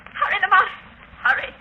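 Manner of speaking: a wounded woman urging Lamont to hurry after the killer; urgent call. Acted. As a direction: hurry him off with real urgency.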